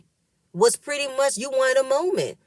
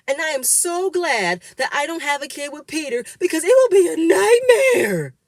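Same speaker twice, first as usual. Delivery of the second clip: high mock scream, rising and louder at the end; imitating a scream; 7 semitones higher, 6 dB louder than usual. Amina screams at Peter, mock shrill yell. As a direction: shriek it out, climbing to a loud peak. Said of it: "Amina is screaming".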